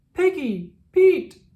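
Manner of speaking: shouting for the lost penguins; yelling names. Acted. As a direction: call out frantically across the yard. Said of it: "yelled Captain Turbot".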